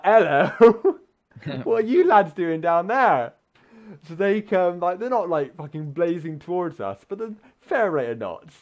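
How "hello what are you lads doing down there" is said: high, loud call across a field, laughing. Retold or imitated. Voicing people calling from the far end of the field, loud laughing shout.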